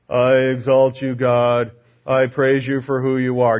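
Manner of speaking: low monotone, droning recitation of praise; flat, unemotional praise. Shown as a demonstration. Preacher mocking how Simeon might have sounded praising God, flat monotone. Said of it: "Do you think that's how it happened?".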